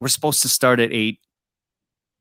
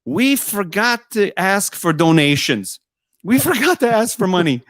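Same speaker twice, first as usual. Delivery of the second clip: high-pitched mock announcement to a room; hammed-up public call, pitched high; imitated yelling; 7 semitones higher than usual. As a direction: imitate someone calling out an interruption. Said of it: "somebody's yelling in the hall".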